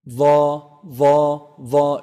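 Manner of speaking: loud, held "Va", tongue tip on upper incisor edge; heavy letter. Shown as a demonstration. Teacher modelling an Arabic letter, "Va" loud and heavy. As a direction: sound "Va" heavy, tongue just visible between the teeth. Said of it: "it's a heavy letter".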